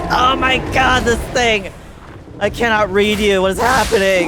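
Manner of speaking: loud alarmed outburst; shouting. Real, not acted. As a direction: yell in panic at the monster. Elephant attack, shouting.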